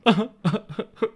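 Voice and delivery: hammed-up fake sobbing laugh, loud and high; a deliberately phoney boo-hoo; mock weeping, clearly insincere; insincere fake cry. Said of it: "cry in a very insincere fashion".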